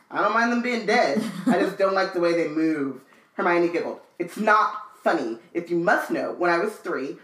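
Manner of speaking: low, even read-aloud voice; story narration. A narrator's reading. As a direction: read Ron's lines out steadily. Reads the book aloud.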